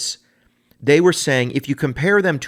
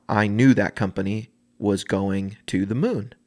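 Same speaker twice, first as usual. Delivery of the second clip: ordinary talk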